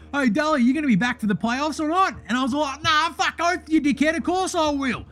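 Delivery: brash bogan voice throughout; Deli character voice. Acted. Host plays the character Deli.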